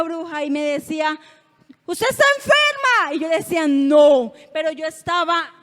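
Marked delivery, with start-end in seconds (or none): 1.9-3.1 high, loud witch's taunt; bruja acusadora. Retold or imitated. Imita a la bruja que la acosa. 3.7-4.3 loud, defiant no; negativa desafiante. Retold or imitated. Le responde a la bruja.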